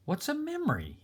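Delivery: put-on high, curious little boy voice; small boy. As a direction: ask it like a wide-eyed child.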